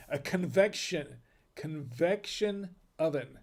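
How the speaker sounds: slow careful. Shown as a demonstration. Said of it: "I can't even say it one time slow".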